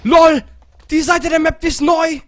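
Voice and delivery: high loud surprise; excited shout. Real, not acted. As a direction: shout it out thrilled. Excited shout at new map.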